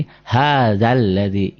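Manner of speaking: long "ha", then join "hadzal ladzi" on a doubled l; merged double l. Shown as a demonstration. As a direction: recite "hadzal ladzi" slowly, short dza into a held l. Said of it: "maka orennya nggak dibaca".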